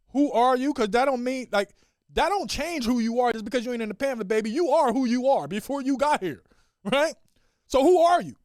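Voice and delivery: loud, high, impassioned dad; rousing pep talk. Acted. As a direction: build the child up with conviction.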